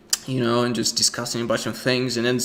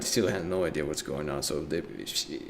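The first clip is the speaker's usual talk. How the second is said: normal talking voice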